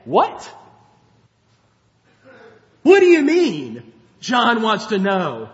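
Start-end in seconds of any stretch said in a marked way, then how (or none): 0.1-3.9 loud, high, exasperated What do you mean; an impatient teacher snapping back at doubters; mock indignant Jesus. Jesus scoffing at John's question, exasperated and loud. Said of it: "Jesus does not say".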